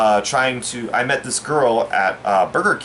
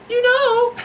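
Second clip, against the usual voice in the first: very high falsetto girlfriend impression; mock-feminine falsetto; girl voice. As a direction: put on a squeaky girl voice. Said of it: "the girl voice".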